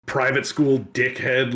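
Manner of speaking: ordinary talk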